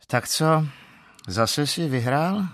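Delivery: wife calls from the bedroom, annoyed; fed-up question. Acted. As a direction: call out, sick of hearing about it. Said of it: "zeptala se otráveně z ložnice".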